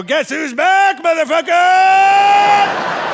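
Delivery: slow, drawn-out shout, pitch climbing high; gloating bellow stretched long; triumphant yell. Retold or imitated. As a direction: crow it out like a victory lap. Triumphant return shouted at drive-thru.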